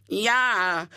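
high, loud schoolboy taunt; jeering shout. A narrator's reading. Hubert jeering at boys outside the window, shouting. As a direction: shout it like a gloating boy. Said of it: "shouted Hubert through the glass".

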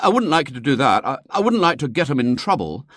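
put-on a boy wriggling out of it; hesitant schoolboy. A narrator's reading. William answers the concerned lady, hesitant schoolboy.

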